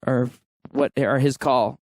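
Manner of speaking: ordinary delivery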